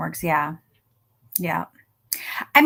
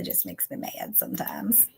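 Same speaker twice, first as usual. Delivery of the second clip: whisper; 12 dB quieter than usual. Real, not acted. Quiet frustrated aside.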